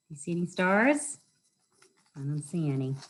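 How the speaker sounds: ordinary delivery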